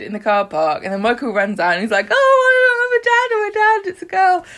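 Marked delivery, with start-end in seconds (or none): 2.1-4.4 loud, very high, rushed squeal; hammed-up ecstatic announcement; overjoyed new dad. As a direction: burst out with the news, breathless-fast and high. Voicing new father running out.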